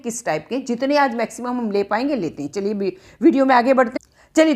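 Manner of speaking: ordinary talk, nothing marked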